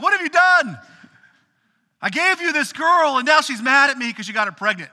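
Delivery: high, loud, accusing outburst; angry wife. Retold or imitated. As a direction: confront him, blaming and indignant. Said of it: "she's like".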